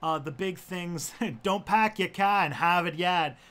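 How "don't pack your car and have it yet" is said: loud, higher mock R-dropping accent; broad vowels and dropped Rs in a joke accent; put-on accent. Shown as a demonstration. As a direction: exaggerate a non-rhotic regional accent. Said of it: "the Southern Ontario accent".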